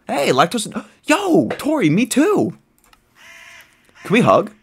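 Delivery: very high squealy delight; gasping high-pitched delight; excited squeal. Real, not acted. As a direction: squeal with joy at a new friend. Thrilled by a villager.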